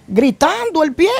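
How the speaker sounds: ordinary delivery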